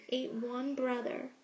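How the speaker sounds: usual speaking voice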